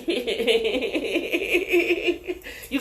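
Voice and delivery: long high giggling cackle; laughing. Real, not acted. Reader cracking up mid-reading, high giggly laugh.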